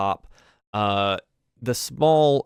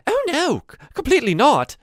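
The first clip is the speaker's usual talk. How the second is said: very high, loud, quick denial; emphatic old goblin maid; 8 dB louder than usual. Acted. Maid backs up the butler, high and emphatic. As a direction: squeal your agreement indignantly.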